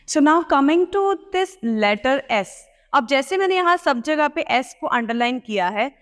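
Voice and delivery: ordinary talk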